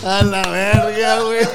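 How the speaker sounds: laughing. Real, not acted. Laughing loud exclamation.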